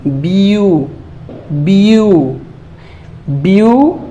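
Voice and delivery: "बी यू" stretched and gliding from ee to oo; long drawn "बी यू" for a beginner reader; sounding out syllables. Shown as a demonstration. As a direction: slowly blend "बी यू" into one sound. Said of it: "फिर यू sound".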